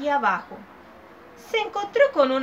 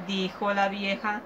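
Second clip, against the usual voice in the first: ordinary delivery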